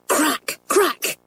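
two short high cracks; imitated firecrack bangs. A narrator's reading.

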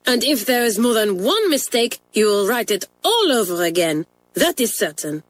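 put-on indignant French-accented tirade, climbing high on cinema; Mamselle furious. French mistress forbidding the cinema trip, angry. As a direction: scold her down hard. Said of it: "raged Mamselle".

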